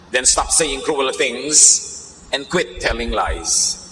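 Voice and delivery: slow, measured Bible reading; scripture reading. Acted. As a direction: read each verse deliberately, for the congregation. Reads scripture in English.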